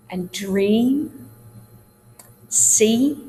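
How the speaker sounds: TEA, DREAM, SEA, CREAM, BEAN with gliding E; drawn-out demo words, E as diphthong; long E diphthong. Shown as a demonstration. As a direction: slide through the E in each word, gliding up. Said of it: "long E sound is turned into a diphthong".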